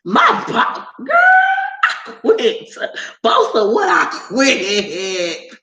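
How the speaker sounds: retold sassy shout quitting on the boss; indignant comeback. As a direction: scoff at the idea she's your boss. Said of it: "I said".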